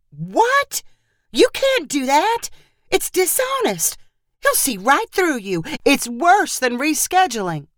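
high, alarmed, scolding mom; alarmed protest. Acted. A mother objects to her daughter faking the interview, alarmed.

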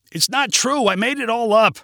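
high, urgent confession; crying out. A narrator's reading. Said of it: "cried Gabe".

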